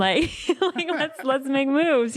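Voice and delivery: high, slowed words through laughter; laughing impatience. Retold or imitated. Fan laughing at the winking singer, high and slow. Retold impatience after his wink.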